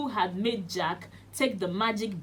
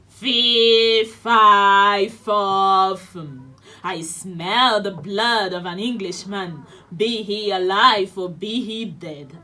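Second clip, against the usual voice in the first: booming, much louder giant chant; giant roaring; 10 dB louder, slower than usual. Acted. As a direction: roar it out like a hungry giant.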